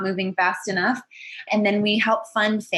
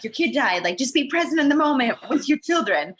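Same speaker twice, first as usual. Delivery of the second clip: self-scolding; 5 semitones higher than usual. Retold or imitated. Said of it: "and then I'm like".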